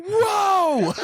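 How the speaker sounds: high, loud drawn-out whoa; hammed-up cry of shock; mock stunned holler. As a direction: imitate a shocked yell, half laughing. Reaction to shotgun blast.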